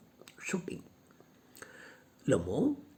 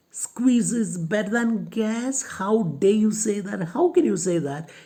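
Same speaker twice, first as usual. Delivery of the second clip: how dare you, offended; indignant Lomov; 2 semitones higher, 5 dB louder than usual. Retold or imitated. Teacher voicing Lomov outraged at Natalia's claim, louder.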